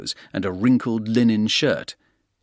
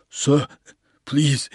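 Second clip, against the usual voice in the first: scared porter; 5 semitones higher than usual. A narrator's reading. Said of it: "he said".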